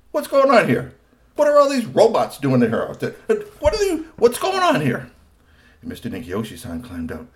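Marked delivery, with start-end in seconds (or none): 0.1-5.1 high, loud demanding cops; stern police. A narrator's reading. Police officers arriving, demanding answers. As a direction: take charge of the scene. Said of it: "they said".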